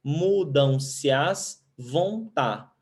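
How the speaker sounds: very slow, each syllable apart; counting syllables. Shown as a demonstration. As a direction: stretch it out so the class can count. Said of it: "Uma, duas, três, quatro, cinco". (bem devagar, separando as sílabas)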